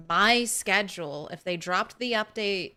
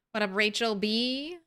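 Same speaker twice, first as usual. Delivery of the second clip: ordinary talk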